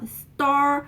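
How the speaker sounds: star with a strong push; stressed star. Shown as a demonstration. Teacher stresses the star in started. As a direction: put force on star. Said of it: "힘을 딱 실어주고".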